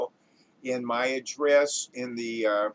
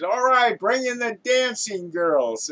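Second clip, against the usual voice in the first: raised, high commanding voice; director's call; 6 semitones higher, 5 dB louder than usual. Acted. Director cueing the dancing girls, calling out. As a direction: call the performers in from afar.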